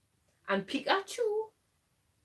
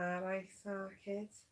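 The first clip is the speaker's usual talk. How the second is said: slow, soft go at Mancunian; Manchester accent; 9 dB quieter, slower than usual. Acted. Co-host tries an Oasis line on request, Manchester accent. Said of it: "Can you do Oasis?".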